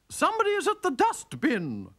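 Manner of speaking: high, loud alarmed cry; wife woken by a clatter, alarmed. Acted. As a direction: wake up startled and cry out. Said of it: "cried Missus Sourpuss".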